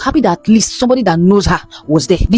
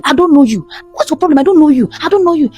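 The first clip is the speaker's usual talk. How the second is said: woman screaming that she doesn't know him; retold frantic shout; 8 semitones higher than usual. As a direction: yell it at him, pitched high. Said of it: "This lady started shouting and saying".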